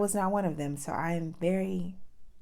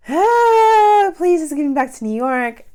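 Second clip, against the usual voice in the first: much higher, loud desperate cry; desperate plea; 11 semitones higher, 13 dB louder, slower than usual. Retold or imitated. Retelling the turbulent flight home, loud high frightened plea. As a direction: beg to get off the shaking plane.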